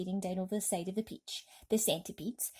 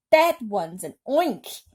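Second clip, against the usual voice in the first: slow, loud scream of alarm; a fire chief screaming in panic; 3 semitones higher, 11 dB louder, slower than usual. A narrator's reading. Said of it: "screamed the head of the fire department".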